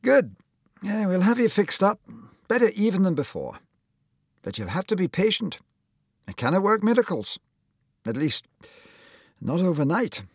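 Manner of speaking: high, hearty Scottish surgeon; encouraging Scottish accent. Acted. Surgeon promising to fix the shoulder, encouraging Scottish accent. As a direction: reassure the lad in a lifted Scottish lilt. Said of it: "smiled encouragingly at him".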